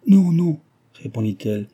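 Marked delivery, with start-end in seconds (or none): 0.1-0.6 high, loud, eager denial; bursting with happy relief; breathless joy. A narrator's reading. Madame Harel, overjoyed, reassures Cécile with a high, loud no. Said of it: "Elle respirait à peine de joie".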